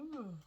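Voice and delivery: high, falling "hmm"; imitated fainting noise. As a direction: mimic someone sinking into a faint. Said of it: "just sort of go".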